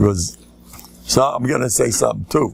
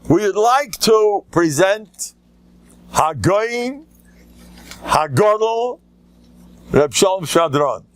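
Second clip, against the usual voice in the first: slow, high, drawn-out formal introduction; high ceremonial announcer voice; grand announcement; 8 semitones higher, slower than usual. Retold or imitated. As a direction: announce him like a master of ceremonies.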